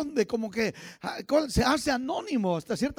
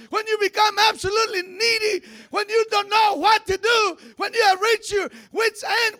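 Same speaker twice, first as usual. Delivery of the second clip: high, loud revival preacher; impassioned pulpit cry in raised pitch; full-force preaching; 9 semitones higher, 7 dB louder than usual. Acted. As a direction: preach it hard, drawing out key words.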